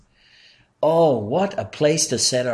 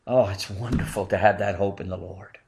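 usual speaking voice

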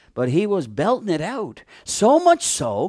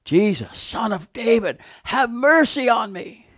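pleading call, pitched up; shouting plea; 6 semitones higher than usual. Acted. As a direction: cry out to Jesus from the roadside. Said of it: "shouting at the top of his lungs".

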